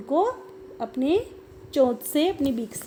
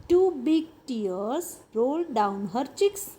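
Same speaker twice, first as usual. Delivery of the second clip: slow story reading; much slower than usual. Shown as a demonstration. Teacher reads story to children.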